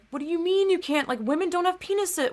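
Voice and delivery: high, disbelieving mock retort; incredulous retort. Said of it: "I'm just like".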